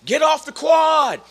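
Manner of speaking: loud, high, drawn-out yell; seniors' yell. Retold or imitated. Seniors ordering freshmen off the quad, loud yell. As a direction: holler it slowly across a campus. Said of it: "the seniors would always yell".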